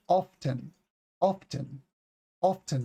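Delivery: "often" with a clear T, off-ten; T pronounced. Shown as a demonstration. Teacher defending the standard form, clearly pronounced T. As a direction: sound the T in often. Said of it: "The T is there".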